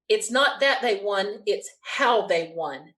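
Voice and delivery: slow, with HOW stretched out for the reveal; stress on HOW. Retold or imitated. Guard revealing the result, slow stress on "how". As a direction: weigh each word, drawing out "how".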